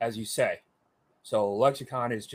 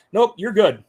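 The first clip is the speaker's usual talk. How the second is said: higher, much louder casual dismissal; breezy instructor; 9 semitones higher, 11 dB louder than usual. Retold or imitated. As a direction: wave him off cheerfully. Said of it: "instructor answers him".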